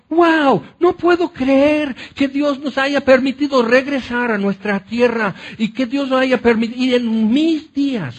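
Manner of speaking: high, amazed wonder at being home; excited young people. Acted. Young exiles marvel at returning home, excited. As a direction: gush like a young man who can't believe it.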